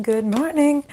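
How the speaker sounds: bright high good morning; high warm-up. Shown as a demonstration. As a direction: lift the voice up to warm it. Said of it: "I really need to warm up".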